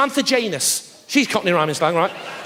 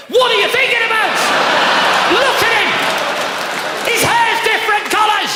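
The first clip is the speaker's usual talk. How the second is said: shouting; 13 semitones higher, 4 dB louder than usual. Real, not acted. Crowd work, mocking shout at audience member.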